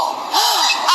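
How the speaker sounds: sharp gasp caught mid-sob; sobbing gasp. Real, not acted. To her critics, a sharp sobbing gasp. Crying breakdown.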